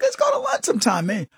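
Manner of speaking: mock eager invite. [name] invites a coworker to lunch in a high eager voice. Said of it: "I was like".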